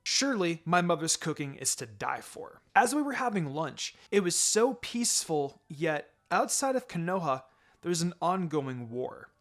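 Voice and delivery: ordinary delivery, nothing marked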